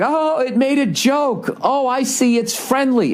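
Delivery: high, slow, fawning impressed voice; mock gushing crowd. Mocking the audience charmed by a robot joke, in a high, slow, gushing voice. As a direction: coo it like dazzled fools falling for the robot. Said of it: "And everybody's like".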